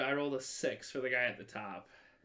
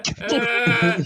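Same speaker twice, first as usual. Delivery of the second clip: loud echo; 8 semitones higher, 14 dB louder than usual. Real, not acted. Echoing the last word loudly.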